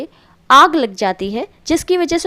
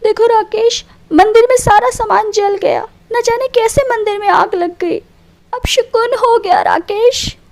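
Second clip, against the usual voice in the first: tearful; 7 semitones higher than usual. Acted. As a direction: wail it to your husband. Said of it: "रोने लगती है".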